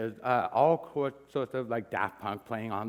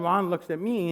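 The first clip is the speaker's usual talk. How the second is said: ordinary talk, nothing marked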